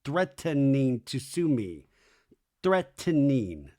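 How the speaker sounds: slow threatening, pulled apart as threat-ten-ning; drawn-out mimicked threatening with an extra syllable; Michelle's THREATENING. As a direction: imitate "threatening" slowly with every syllable separate. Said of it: "makes an extra syllable in threatening".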